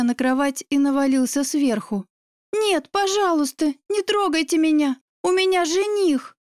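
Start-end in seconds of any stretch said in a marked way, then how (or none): 2.5-6.4 high, frightened begging; desperate pleading. Acted. Trapped girl begs the attacker to stop, high desperate plea. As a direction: plead in a raised, urgent pitch.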